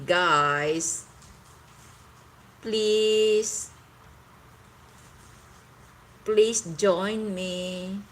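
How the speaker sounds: ordinary delivery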